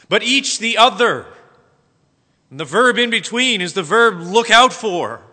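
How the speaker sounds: loud, high, deliberate verse exposition; emphatic preaching. Acted. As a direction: hammer home each phrase of the verse. Reads and expounds verse emphatically.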